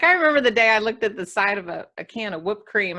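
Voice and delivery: usual speaking voice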